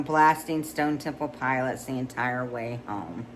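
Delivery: ordinary delivery, nothing marked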